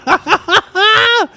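high-pitched laughing fit. Real, not acted. Laughs at own story.

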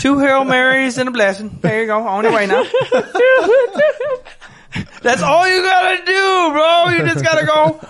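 loud, high, hammed-up priest voice laughing through it; mock priest. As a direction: lay it on like a jolly priest.

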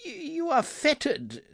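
high, stammering fear; trembling. A narrator's reading. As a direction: quaver it out.

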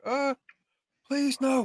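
high, whimpering beg; mock a crying man begging for his life; imitated crying plea. Retelling the wounded man's pleas, mock crying. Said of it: "he's crying. Like".

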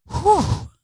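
a whistled high "Whew" of disgust; high mock whew. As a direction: squeak out "Whew" like a coworker hit by a smell. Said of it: "The other one's just going".

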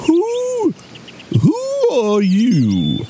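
high, drawn-out owl hoot on who; slow, high-pitched owl voice; hooting old owl. A narrator's reading. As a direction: stretch the question like a hooting owl.

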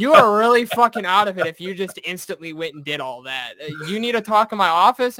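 stern drill instructor. Retold or imitated. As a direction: scold like a fed-up drill instructor. Drill instructor scolding recruit.

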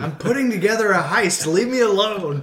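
hammed-up schemer shooing people off; mock villain. Mock villain plotting bit.

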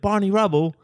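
high, loud mock Cockney. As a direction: throw it out in a hammed-up Cockney. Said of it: "doing that rhyming Cockney slang".

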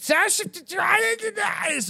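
high, slow, shouted fake German accent; a hammed-up German accent yelled high; mock German shout. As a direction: bark it out like a bad German accent. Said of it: "I cannot do a German accent".

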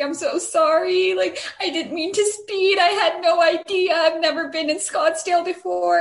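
imitating a tearful apology, high-pitched; mock crying. Crying driver apologizing to the cop, imitated. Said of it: "She starts crying".

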